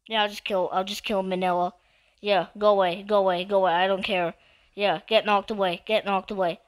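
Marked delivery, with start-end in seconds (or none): none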